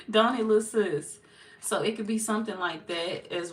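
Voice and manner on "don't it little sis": mock-envious ribbing of a lazy kid, a bit louder; teasing a kid. Adult teasing a kid with no job, mock envy a bit louder. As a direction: rib the child about the easy life, a bit louder and higher. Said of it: "can talk to a child like that".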